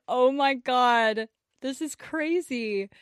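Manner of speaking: slow, drawn-out amazed oh my God; slow imagined delighted gasp; delighted gush. Retold or imitated. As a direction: savour it slowly in delight.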